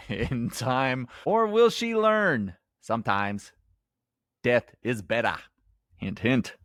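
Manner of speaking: bad New England accent, drawn out. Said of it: "my really bad New England accent".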